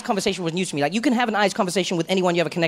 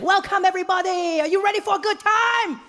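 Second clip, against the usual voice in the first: much higher, beaming guide voice; overjoyed tour guide; 12 semitones higher than usual. Retold or imitated. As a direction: greet the group way too happily. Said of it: "he's just like".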